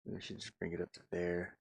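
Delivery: ordinary delivery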